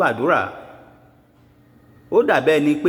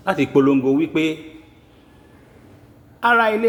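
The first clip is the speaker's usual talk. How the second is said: raised, ringing herald's call to the kings; town crier proclamation; 4 semitones higher than usual. Acted. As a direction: proclaim it like a crier announcing war to every ruler. Proclamation over a list of kings.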